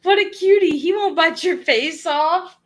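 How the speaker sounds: high, gushing mock dog-owner voice; poking fun at dog owners with a high gushing voice; mocking dog people. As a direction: gush like a clueless dog owner. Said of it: "Dog people be like".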